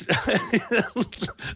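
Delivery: high-pitched burst of giggling; can't hold back a squeaky laugh; giggling fit at a chat message; high giggle. Real, not acted.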